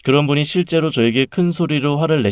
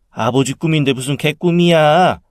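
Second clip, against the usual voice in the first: higher-pitched, mildly affronted aunt; indignant objection; 4 semitones higher than usual. A narrator's reading. Aunt protests to her angry sister, indignant. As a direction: push back in protest.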